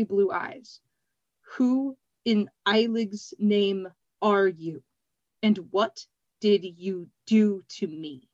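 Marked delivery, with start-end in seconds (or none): 1.6-8.3 slow, deliberate words, each one placed; slow and careful. A narrator's reading. Glassblower to a girl, slow careful speech. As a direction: speak slowly and carefully, hiding rage. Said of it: "He spoke slowly and carefully".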